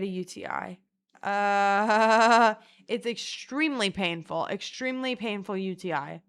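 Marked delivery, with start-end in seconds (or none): none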